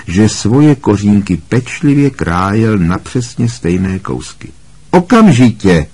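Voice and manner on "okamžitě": raised, louder order from a menacing teacher; dangerous command. A narrator's reading. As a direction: order it sharply, higher and louder. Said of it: "pobídl ho Snape svým nejnebezpečnějším hlasem". (výhrůžný, důrazný rozkaz)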